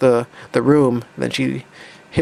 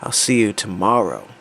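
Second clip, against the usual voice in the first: low, measured, understated menace; threatening; 4 semitones lower than usual. Acted. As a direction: deliver a veiled threat in a lowered voice. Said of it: "makes a very threatening face, saying that".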